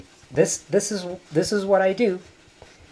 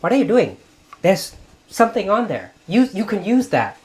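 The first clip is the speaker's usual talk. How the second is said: scolding aunt; 6 semitones higher, 6 dB louder than usual. Retold or imitated. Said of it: "She'd go".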